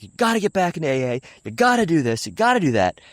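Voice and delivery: high, insistent mock rant; imitated yelling. Angry dad ordering his child to get sober, mock yelling. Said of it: "he's just yelling".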